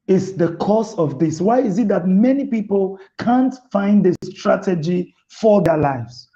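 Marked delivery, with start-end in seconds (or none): none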